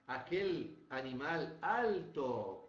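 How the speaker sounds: ordinary talk, nothing marked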